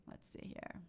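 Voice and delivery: breathy whisper to herself; whispered aside. Real, not acted. Host scanning chat messages, whispered aside.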